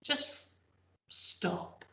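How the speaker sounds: hushed, drawn-out pause; dramatic whisper. Acted. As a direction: drop to a breathy hush. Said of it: "time for the whole of Maastricht to".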